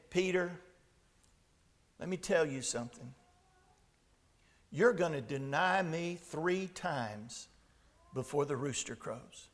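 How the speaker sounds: low, hushed retelling of a grave prediction; quiet solemn warning. Retold or imitated. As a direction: deliver the warning softly and low, with long pauses. Jesus gravely foretells Peter's denial.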